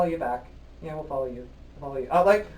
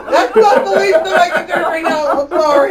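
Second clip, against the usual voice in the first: loud laughing apology, much higher; 12 semitones higher, 12 dB louder than usual. Real, not acted. Host laughing after flubbing the station name, loud giggles. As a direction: crack up at your own mistake.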